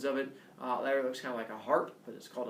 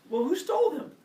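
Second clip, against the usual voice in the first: high, louder Hermes asking; a high, loud, astonished question; 13 semitones higher, 5 dB louder than usual. Retold or imitated. Hermes voiced in dialogue.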